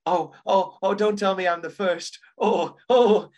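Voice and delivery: imitating someone all in a fluster. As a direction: act out a flustered entrance, oh after oh. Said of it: "And she's all flustered".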